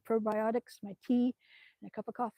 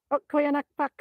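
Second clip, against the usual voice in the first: excited greeting; 5 semitones higher, 8 dB louder than usual. Real, not acted. Greeting chat with her catchphrase.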